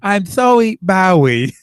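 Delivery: loud, high comic quote with a speech lisp; Big Bang Theory quote. Pretend. Host quotes his favourite Big Bang Theory bit to the co-host. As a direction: ham up the sitcom line. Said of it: "my favorite bit of Big Bang Theory".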